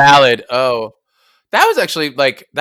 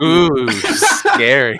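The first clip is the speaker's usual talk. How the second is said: very slow, drawn-out, higher mock fright; mock-spooky; 4 semitones higher, much slower than usual. Cast member echoing the newscaster, mock-spooky. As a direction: stretch it out with playful dread.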